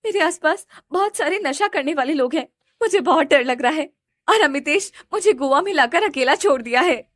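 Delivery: scared and alone, begging for help; terrified sister on phone; frightened. A narrator's reading. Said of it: "रिया घबराते हुए बोलती है".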